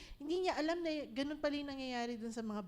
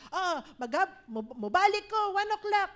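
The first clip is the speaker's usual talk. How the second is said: louder, calling back across the yard; loud mock call to the kids; 7 semitones higher, 8 dB louder than usual. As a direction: shout the time over the noise. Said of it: "sabi niya". (pasigaw na tawag sa mga bata)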